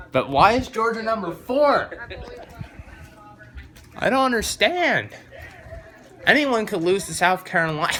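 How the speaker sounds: high, loud exasperated outburst. Real, not acted. Fan ranting about Georgia's ranking, loud exasperation. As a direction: throw up your hands, loud and high.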